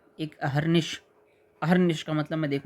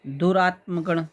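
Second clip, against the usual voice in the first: ordinary talk, nothing marked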